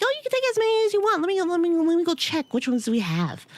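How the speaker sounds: high, fast, eager helper; eager volunteer. Retold or imitated. The volunteer offering flags, high and fast. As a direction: rush it out, eager to help.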